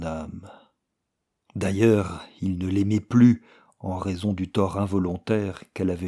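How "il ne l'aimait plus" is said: usual speaking voice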